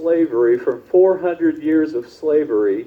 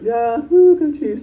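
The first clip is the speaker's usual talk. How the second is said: answering cheer; 13 semitones higher, 4 dB louder, slower than usual. Retold or imitated. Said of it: "And we went".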